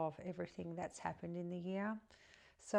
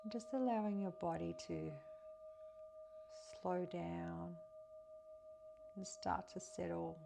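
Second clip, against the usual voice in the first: softer, calm guiding voice, phrase by phrase; guided meditation; 2 semitones higher, 6 dB quieter than usual. Acted. Guiding listeners through breath and a pier visualization, meditative.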